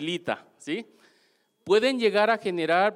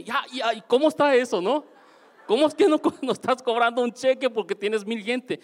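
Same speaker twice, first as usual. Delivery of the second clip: can't believe what she says; mock bafflement; puzzled disbelief; 6 semitones higher than usual. Retold puzzled questioning.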